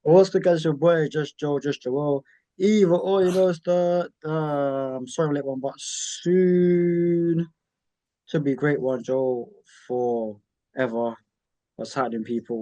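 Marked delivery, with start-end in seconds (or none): 0.0-11.2 hype intro. Acted. Podcast host's catchphrase intro.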